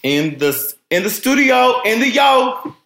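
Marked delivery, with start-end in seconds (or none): 0.9-1.8 high, hyped-up "studio"; excited. Pretend. Teasing echo of studio line. 1.8-2.7 "yo" tossed off high and loud, clipped slang; slangy. Pretend. Slangy clipped repeat of studio.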